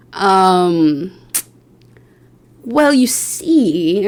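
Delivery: slow, sheepish stall. Retold or imitated. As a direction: drag it out like a guilty excuse. Retold excuse to stylist.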